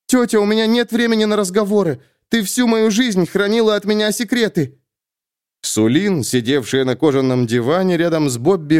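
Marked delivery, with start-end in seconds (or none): 0.1-4.8 put-on higher, urgent young heroine; young woman, urgent. A narrator's reading. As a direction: press it, tense and scared. Sulin confronts aunt.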